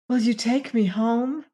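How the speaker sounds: lightly voiced plea for help; imploring. A narrator's reading. Sister asking her departing brother for help, imploring. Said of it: "his sister implored".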